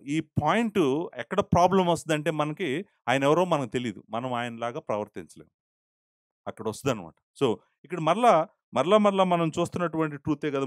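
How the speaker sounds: ordinary talk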